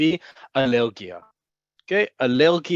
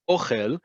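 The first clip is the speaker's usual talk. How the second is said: "ochel" with the stress on OH; first-syllable stress. Shown as a demonstration. Teacher asks learners to listen, first-syllable stress in "ochel". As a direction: lean on the opening O of "ochel", khel light. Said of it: "emphasis on the first syllable".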